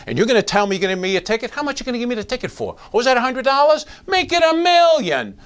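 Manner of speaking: hammed-up outraged citizen, climbing in pitch; mock incredulous citizen. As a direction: pretend indignation winding up to a sarcastic peak. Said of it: "They're gonna say that to you".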